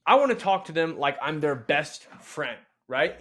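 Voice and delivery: ordinary talk, nothing marked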